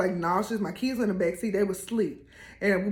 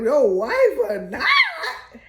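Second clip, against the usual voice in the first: slow, loud, higher disbelieving shout; incredulous; 5 semitones higher, 5 dB louder, much slower than usual. Retold or imitated. [name] echoing the coach's wife remark, slow loud disbelief. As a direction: drag it out in stunned disbelief. Incredulous echo of his words.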